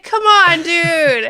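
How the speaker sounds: high, very loud exasperated shout; yelling in protest at the screen; outraged yell. Retold or imitated. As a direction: holler in mock outrage. Retells own outraged reaction to scene.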